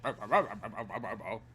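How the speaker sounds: low mock gibberish; imitating game sound. Imitating Slippy's SNES voice, low gibberish. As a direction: mimic the game's low babble. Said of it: "they give him a deeper voice".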